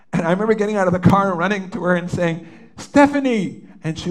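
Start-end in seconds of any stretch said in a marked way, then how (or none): 2.8-3.7 loud high call across the street; calling her name. Retold or imitated. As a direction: shout her name as you run to her. Running to daughter calling name.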